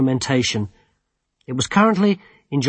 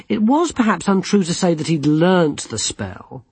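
plain reading voice

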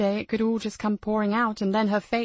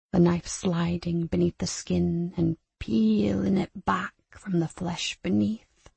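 menacing slow voice; 2 semitones lower, slower than usual. Acted. Abuser menacing child Lucy.

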